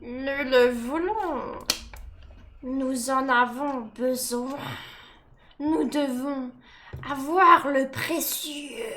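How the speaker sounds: slow, drawn-out creature craving; slow, obsessive creature voice; Gollum. Acted. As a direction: stretch each word out covetously.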